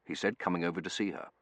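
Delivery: ordinary delivery, nothing marked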